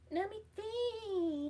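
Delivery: high, slow musing; drawn-out high thinking tone. Real, not acted. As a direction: stretch it out while thinking. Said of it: "what happens naturally when I think".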